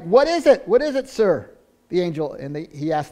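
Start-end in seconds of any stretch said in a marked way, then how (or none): 0.0-1.5 higher and louder, startled and scared; frightened question. Acted. Cornelius facing the angel, terrified higher outburst. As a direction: blurt it out in fear. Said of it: "he's looking at him in terror".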